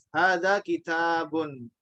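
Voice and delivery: Hadza with a long a after the dz, read slowly; long alif. Shown as a demonstration. Teacher shows the long alif, slowly. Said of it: "dia tegak karena dia alif".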